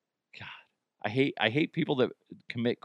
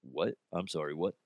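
much lower, softer baffled question; confused listener; 8 semitones lower, 9 dB quieter than usual. Retold or imitated. Host voices a confused listener, much lower and softer. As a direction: murmur it, lost and confused. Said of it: "And you're like".

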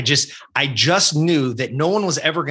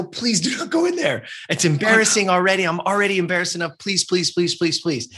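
put-on higher, pleading voice of a panicked kid; desperate begging; 5 semitones higher than usual. Boy begging his mom not to go inside, high pleading. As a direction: beg over and over in a raised pitch.

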